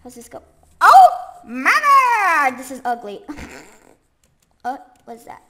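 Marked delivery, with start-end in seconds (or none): 0.8-2.6 dismayed exclaim. Real, not acted. Reacts to ugly build.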